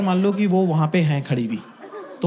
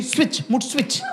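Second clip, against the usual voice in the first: higher, soft coaxing; mock cheery coaxing; 5 semitones higher, 4 dB quieter than usual. Comic mimics girlfriend trying to switch his mood. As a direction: coax him lightly up high.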